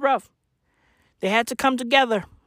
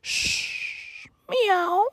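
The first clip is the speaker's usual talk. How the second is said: whispered shh then a much higher meow; shushing cat; 10 semitones higher, 4 dB quieter than usual. Acted. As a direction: hush him, then purr a meow. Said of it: "Catwoman is gonna be like".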